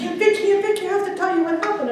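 high, eager rush of news; a kid bursting to tell his aunt; excited nephew. Retold or imitated. Nephew home from work, telling his aunt, excited high voice. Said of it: "he was all excited".

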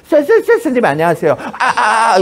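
쎄, 쎄, 쎄 stuttered before the greeting; imitated stutter, getting stuck on 쎄 and 아; comic stutter. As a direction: stammer on the first syllable, 쎄, 쎄, 쎄. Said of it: "내가 말더듬이가 됐어요".

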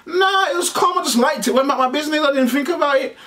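high exasperated defence; excited protest. Retold or imitated. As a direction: insist you did nothing wrong, voice up high. Defending himself, retold.

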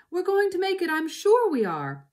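rally the family with a lifted voice; encouraging fox. A narrator's reading. Mister Fox reassuring his family, high rallying voice. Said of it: "Mister Fox would say".

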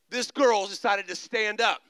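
ordinary talk, nothing marked

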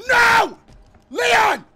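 high, full-volume scream; panicked scream. Real, not acted. Gamer screaming for Leon as danger hits, panicked scream. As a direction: scream the name in sudden alarm. Attacked in game.

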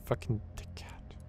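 unvoiced muttered curse; whispered insult. Real, not acted. Gamer insulting the fish under his breath, whispered. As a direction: whisper it behind his back.

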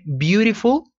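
Beautiful said high and rising for repeating; teacher sets Beautiful up high for the class; model pronunciation. Shown as a demonstration. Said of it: "Listen to the pronunciation".

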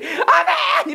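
very high, squealing mock cheer; imitated congregation amen. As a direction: cry out like an overjoyed congregation, hammed-up. Imagined congregation responding with amen.

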